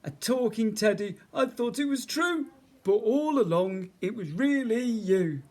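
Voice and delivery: high, loud, amused voice; giggling bear. A narrator's reading. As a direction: marvel in jolly, giggly bear tones. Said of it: "he giggled".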